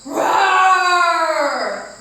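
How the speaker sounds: loud drawn-out roar; lion roar. Shown as a demonstration. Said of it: "on your exhale, you will".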